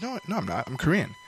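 small, lightly acted voice insisting; soft denial. Girl denying it to her cousin, soft protest.

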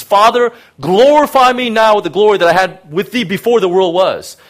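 a preacher quoting Jesus praying; fervent prayer. Retold or imitated. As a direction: lift it up like a cry to heaven.

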